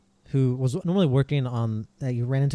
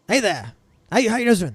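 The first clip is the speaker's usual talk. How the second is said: high, loud, eager guard greeting; friendly guard calling out higher and louder; Ronald; 9 semitones higher, 6 dB louder than usual. Acted. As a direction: hail them brightly from across the gate. Ronald hails the approaching party, saluting.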